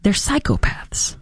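hushed scorn, half to yourself; disgusted whisper. Real, not acted. Host whispering scorn about anti-ice protesters, disgusted whisper. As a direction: whisper it with contempt.

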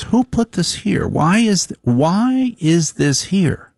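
high, slow mock wife voice; nagging wife. Husband mocking his wife's morning complaint, high and whiny. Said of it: "She goes".

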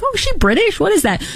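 high, mock-baffled questioning; puzzled onlooker. Viewer puzzling over a housewife's affected accent, mock bafflement. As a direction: act out someone taken aback, pitched well up.

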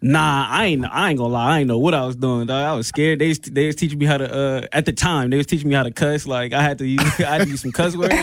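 ordinary talk

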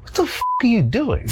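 high, baffled mock outrage; exasperated at TikTok girls dancing; incredulous. Talking about TikTok girls, incredulous high disbelief. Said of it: "And I'm like".

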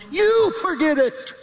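high-pitched preacher's command; emphatic exhortation. Real, not acted. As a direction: drive it home with a soaring "you". Preacher's climactic command to congregation.

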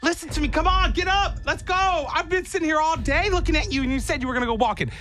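high-pitched whiny talking dog rant; exasperated dog. Acted. Dog pestering fallen owner about the promised walk, high exasperated whine. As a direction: nag like a dog desperate for its walk.

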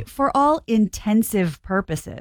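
slow, spelled-out "for all INTENSIVE PURPOSES"; quoting a misused phrase. Shown as a demonstration. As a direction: draw out "intensive purposes" slowly. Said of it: "a lot of people say".

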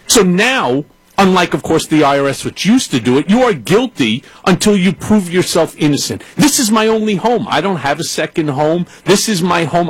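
ordinary talk, nothing marked

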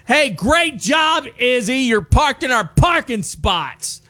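mock angry yell. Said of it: "they yell back".

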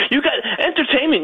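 words squeezed out through a high laugh. Real, not acted. Telling the host about his dates, laughing. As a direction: laugh right through the line, pitch up.

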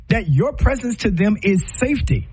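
ordinary delivery

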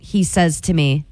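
usual speaking voice

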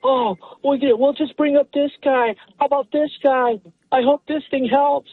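mock hopeful management. Caller voices management's yearly hopeful excuses.

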